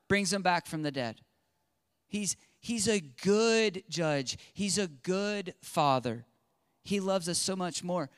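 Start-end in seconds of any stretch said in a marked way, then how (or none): none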